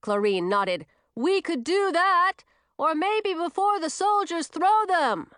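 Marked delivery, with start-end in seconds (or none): 1.1-5.3 high, booming agreement; high-pitched hearty character voice; loud witch. A narrator's reading. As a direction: holler the idea high and eagerly.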